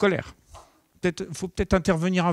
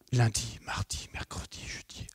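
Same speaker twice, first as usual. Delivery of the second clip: whispered count; 4 semitones lower, 8 dB quieter than usual. Shown as a demonstration. Trainer whispering the passing days. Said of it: "counting days under breath".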